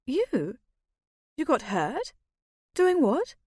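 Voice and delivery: rising, startled questions; surprised. A narrator's reading. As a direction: jump up high in disbelief. Eyes wide, shocked at news.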